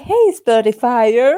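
slow, drawn-out imitation of an advert; advert catchphrase. Host quoting the new advert to listeners, mock announcer call. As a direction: stretch it out like an ad greeting. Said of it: "διαφήμιση που λέει".

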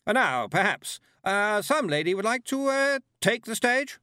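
high, loud, over-bright hostess; bright as glass about to shatter, with halting uhs; brittle brightness. A narrator's reading. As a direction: coax the audience with forced cheer. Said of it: "as brightly as a glass ball about to shatter".

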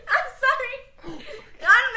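high, loud laughing words; giggling fit, high and loud. Real, not acted. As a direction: squeal through real laughter. Laughing after weird touch.

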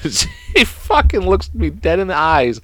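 high, slow words pushed through laughter; laughing. Real, not acted. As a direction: crack up while telling it. Cracks up telling the anecdote.

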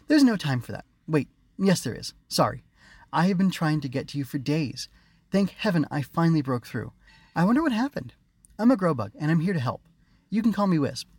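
high, chirpy sprite voice; butterfly sprite. A narrator's reading. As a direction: speak like a tiny magic bug. Wisp character voice.

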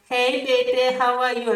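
very high, sugary mock aunty greeting; fake-sweet aunty. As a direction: coo it like a nosy aunty. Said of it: "they're like".

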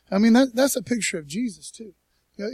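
ordinary talk, nothing marked

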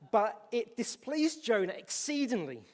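a sudden loud, forceful reading; emphatic proclamation. Acted. Preacher reads with sudden force.

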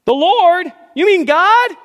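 very high, loud disbelief; astonished shout. Acted. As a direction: gasp it out amazed. Jack voiced in joke.

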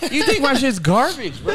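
laughing, high and loud, mock hurt; laughing mock offence. Real, not acted. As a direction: toss it out through a laugh, pretending to be insulted.

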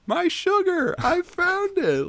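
very high, loud mock exclamation; mimicking movie girl. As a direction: squeal it straight at the camera, hammed-up. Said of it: "looks right at the camera and goes".

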